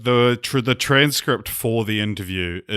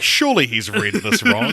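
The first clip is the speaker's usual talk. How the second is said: imitating incredulous thought; 12 semitones higher, 3 dB louder than usual. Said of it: "you're like".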